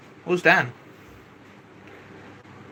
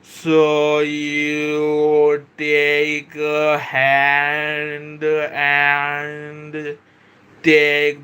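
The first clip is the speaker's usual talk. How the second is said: extremely slow, dragged-out words; exaggerated slow motion; 4 semitones lower, much slower than usual. Shown as a demonstration. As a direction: drag each word out as a joke for the viewer who asked him to slow down. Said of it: "you want more slow?".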